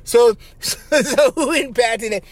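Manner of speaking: high, laughing mock listener voice; mimicking listeners. Host teases listeners with a high laughing impression of them. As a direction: squeak it like a goofy fan. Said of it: "that's how you guys sound".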